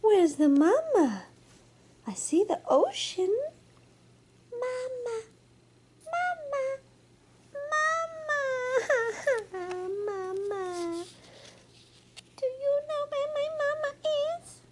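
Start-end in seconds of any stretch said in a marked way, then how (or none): 4.5-11.1 put-on high squeaky baby animal calls for mama; baby walrus calling. 12.4-14.6 put-on high, fast little baby voice; baby walrus voice. Baby walrus asks for mama.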